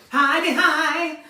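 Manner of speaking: loud, slow singsong catchphrase; Sue Pollard. Acted. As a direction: chime it out, stretching each beat. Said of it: "Got to be quite on the edge".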